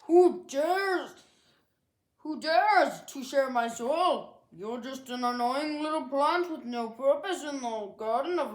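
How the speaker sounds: a towering flower roaring down at a tiny weed; roaring giant sunflower. Acted. The giant sunflower roars at Dandelion to get out, booming. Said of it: "The sunflower roared".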